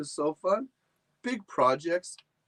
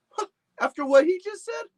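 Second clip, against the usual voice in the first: indignant dog; 5 dB louder, faster than usual. Acted. Dog outraged at the squirrel.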